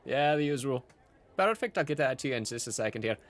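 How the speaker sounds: bubbly innkeeper, high exclamation settling into chatter; Gideon. Acted. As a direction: welcome the regulars brightly and take their order.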